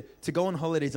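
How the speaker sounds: usual speaking voice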